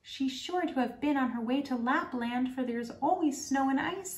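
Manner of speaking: a wood pigeon cooing its news. Acted. Wood pigeon tells the robber girl about Kay, high cooing bird voice.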